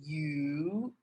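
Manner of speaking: "yu" spoken slowly and clearly as the Mandarin word for fish; slow, careful "yu". Shown as a demonstration. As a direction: draw out "yu" carefully for learners. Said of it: "it's pronounced".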